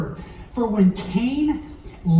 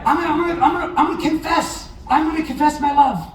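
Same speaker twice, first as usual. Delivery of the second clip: excited shout; 7 semitones higher, faster than usual. Real, not acted. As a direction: cry it out like a big announcement. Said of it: "preacher building excitement".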